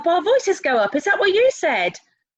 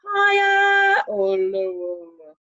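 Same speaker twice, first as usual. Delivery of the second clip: HIGHER held on a high note, LOWER held low; very slow, near-sung contrast of HIGHER and LOWER; high then low; 3 semitones lower, much slower than usual. Shown as a demonstration. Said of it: "tells us whether we need to go".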